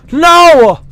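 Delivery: loud, high cry of dismay; anguished shout. Real, not acted. Streamer gets killed, high loud shout. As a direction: yell out as you die.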